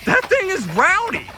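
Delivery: high, slow amazed exclaim; awed excitement. Real, not acted. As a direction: stretch it out in awe. Awed by car's speed.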